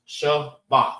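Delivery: Shabbat split into Sha... bat; two syllables. Shown as a demonstration. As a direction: sound out Shabbat in two separate beats. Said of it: "Two syllables".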